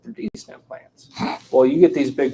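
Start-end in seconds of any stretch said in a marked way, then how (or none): 0.0-1.1 whispered, embarrassed admission; hushed mock answer; sheepish whisper. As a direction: drop to a breathy confession.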